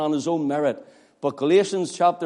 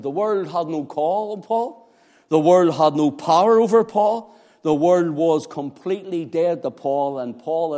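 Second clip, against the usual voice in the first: ordinary delivery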